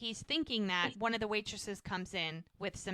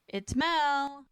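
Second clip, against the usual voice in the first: higher, louder call with a held ending; 6 semitones higher, 6 dB louder than usual. Retold or imitated. Woman at the bedroom door saying who it is, calling out. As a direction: imitate announcing yourself through a door.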